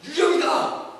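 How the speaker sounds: scared shout of ghost; frightened cry. Acted. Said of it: "막 소리쳤습니다".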